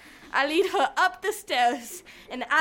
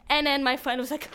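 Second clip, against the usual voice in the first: ordinary delivery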